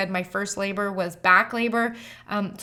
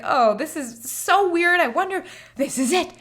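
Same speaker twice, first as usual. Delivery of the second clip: pitched-up amazed giggle; giddy disbelief; 7 semitones higher than usual. Retold or imitated. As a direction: marvel that it's finally happening. Realising labor starting.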